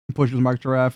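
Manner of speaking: ordinary delivery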